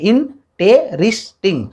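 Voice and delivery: "इंटेरिस्टिंग" broken into four separate beats; syllable by syllable. Shown as a demonstration. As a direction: count out the syllables of "इंटेरिस्टिंग" as you say it. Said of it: "चार बार हमारा बोल रहे हैं". ("इंटेरिस्टिंग" को चार हिस्सों में तोड़कर बोलें)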